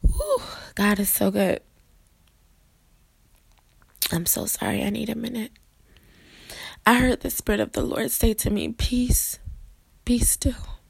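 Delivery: choked up, pausing to collect yourself; tearing up. Real, not acted. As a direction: fight back tears while testifying. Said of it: "I'm about to tear up".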